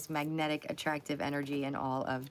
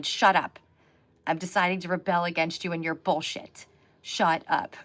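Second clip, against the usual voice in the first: loud, firm shut up to the overthinking mind; rebellious shout; 6 dB louder than usual. Acted. Reader voicing the card's sword telling the overthinking mind: shut up, loud. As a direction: snap at the overthinking brain to shut up. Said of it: "and going".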